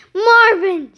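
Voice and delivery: indignant cry. A narrator's reading. Missus Finnegan learning who did it, indignant cry. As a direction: cry the name in outrage. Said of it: "she cried".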